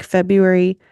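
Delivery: ordinary delivery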